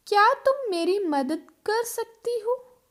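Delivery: ordinary delivery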